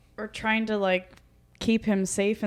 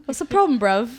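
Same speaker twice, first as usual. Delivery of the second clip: mock British accent; 2 semitones higher, 6 dB louder than usual. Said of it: "speak with a British accent".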